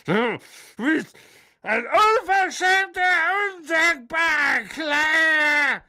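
high, loud, drawn-out scream; furious shout. A narrator's reading. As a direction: yell every word in fury. Magda raging.

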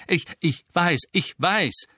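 ordinary delivery

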